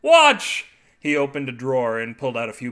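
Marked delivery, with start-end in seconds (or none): 0.0-0.7 high, loud one-word yell; screamed command. A narrator's reading. Husband demanding wife watch his demonstration, screamed. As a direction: yell for her attention.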